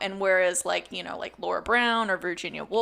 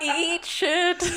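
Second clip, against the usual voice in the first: mock insult; 10 semitones higher, 5 dB louder than usual. Joking insult after the bit.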